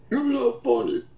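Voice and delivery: high, laughing mimicry of a lazy insult; mocking quote. Mocking a critic who only says you're not funny, high and laughing. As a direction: parrot the critic's empty line with a laugh. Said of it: "other than just going".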